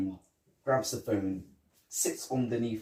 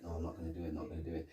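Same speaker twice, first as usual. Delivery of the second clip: muttering to himself; 4 semitones lower, 11 dB quieter, much faster than usual. Retold or imitated. Arguing with himself.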